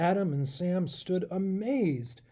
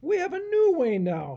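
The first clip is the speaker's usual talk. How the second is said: boastful goat; 12 semitones higher, 6 dB louder than usual. Acted. Goat professor boasting of his new way, bleating. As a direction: crow it like a proud goat.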